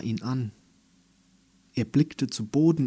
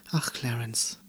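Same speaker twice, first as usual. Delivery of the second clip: whispered tenderly; soft whisper; 5 dB quieter than usual. Acted. Andy hugging Clarence, whispering gently. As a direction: breathe the name softly, without voice. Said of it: "sagte sie sanft".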